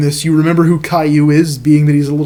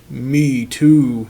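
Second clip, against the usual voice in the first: mock delight; 2 semitones lower than usual. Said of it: "said Clementine in delight".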